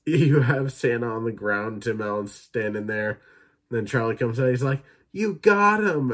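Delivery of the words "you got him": put-on high, loud, slow kid cheer; thrilled kid. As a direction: cheer it like a delighted child, up high. Charlie thrilled, dad killed Santa.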